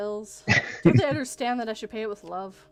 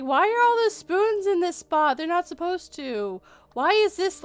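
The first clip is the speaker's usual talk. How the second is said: higher, lightly voiced puzzled kid; 7 semitones higher than usual. Retelling a child questioning the spoons, lightly voiced kid. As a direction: ask it like a curious child. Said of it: "one of the kids is like".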